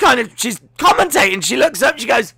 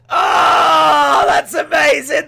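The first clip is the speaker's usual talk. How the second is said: thrilled; 10 semitones higher, 4 dB louder, much slower than usual. Real, not acted. Thrilled exclamation after goal.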